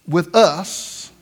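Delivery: "us" set apart and drawn out; stress on US. Shown as a demonstration. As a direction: repeat it pointedly, leaning on "us". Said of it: "That sounds like more than one".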